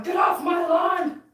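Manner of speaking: hammed-up get-off-my-lawn old man; grumpy old man shout. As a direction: bark it like a cranky neighbor at his window. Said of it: "leaning out the window going".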